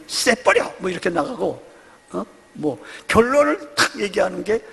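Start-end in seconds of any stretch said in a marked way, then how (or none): 0.1-0.7 high, abrupt Gyeongsang-style blurt; Gyeongsang bluntness. Retold or imitated. Preacher imitating a Gyeongsang spouse, blunt Gyeongsang accent. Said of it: "경상도 쪽의 기질입니다".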